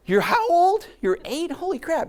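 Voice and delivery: amazed double take; high astonished question. Retold or imitated. Astonished at boy's age.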